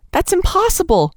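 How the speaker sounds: high, pleading cry; cried out. A narrator's reading. Nellie crying out at the doctor, high and pleading. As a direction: cry it out in protest. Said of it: "cried Nellie".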